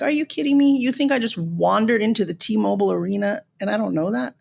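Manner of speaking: normal talking voice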